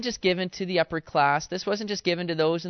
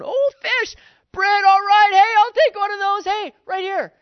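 much higher, louder, grabbing for food; clamoring crowd; 10 dB louder than usual. Acted. Crowd grabbing at food, high loud excited calls. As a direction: shout over each other for the fish. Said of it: "Everybody going".

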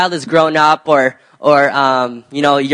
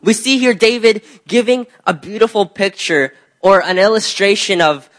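ordinary talk

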